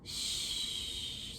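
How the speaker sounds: a soft, breathy shush; hushing shh. Acted. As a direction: hush them so the friends keep sleeping. Friends asleep, hushing listeners.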